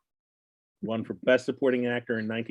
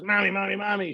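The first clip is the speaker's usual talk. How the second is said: put-on higher eager little boy calling; excited kid; 8 semitones higher than usual. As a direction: call for mom like an eager kid. Child voiced in joke.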